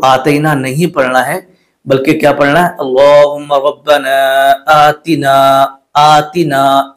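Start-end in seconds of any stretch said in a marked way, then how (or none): 2.7-6.9 आतिना slow, clear "ti"; correct recitation. Shown as a demonstration. Teacher models the correct reading. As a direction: recite आतिना carefully and slowly. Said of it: "इस तरीके से पढ़ना है".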